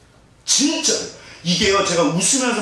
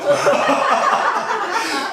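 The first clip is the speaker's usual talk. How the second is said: a burst of high laughter; real giggle, pitched up. Real, not acted. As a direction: laugh high at your own joke. Laughing at own story.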